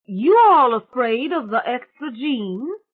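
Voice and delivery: loud, high, slow call to the whole room; loud, high, slow challenge to the whole family; voice thrown out. Acted. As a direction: throw the voice out like a lasso. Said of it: "thrown her voice out like a lasso".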